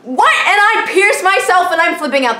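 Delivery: exasperated rant. Real, not acted. Exasperated rant, high and loud.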